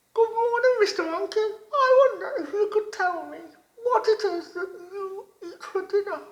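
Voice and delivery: high, loud, bright-eyed little frog voice; wide-mouthed frog voice. A narrator's reading. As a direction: squeak it out like an eager little frog. Frog greets the monkey.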